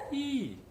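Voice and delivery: I said high and drawn out; high I vowel; I sound demo. Shown as a demonstration. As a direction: sing the I up high. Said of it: "Então você vai falar".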